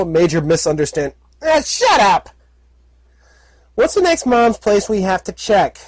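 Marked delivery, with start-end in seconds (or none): 1.4-2.3 high irritated outburst; annoyed snap. Acted. Filly cuts off suit. 3.7-5.8 high, chirpy filly voice; young filly voice. Acted. Filly character voice.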